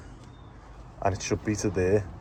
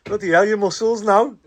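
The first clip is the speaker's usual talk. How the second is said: higher-pitched mock astonishment; playful retold amazement; amazed missus; 10 semitones higher, 4 dB louder than usual. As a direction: imitate an impressed wife, pitched well up. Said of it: "his missus was like".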